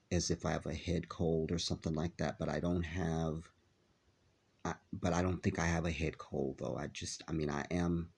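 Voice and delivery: ordinary delivery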